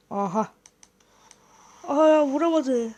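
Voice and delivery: ordinary talk, nothing marked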